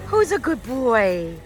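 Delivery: slow, singsong praise for a dog; cooing at a little dog she found; sweet dog talk. Real, not acted. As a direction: coo it at the puppy.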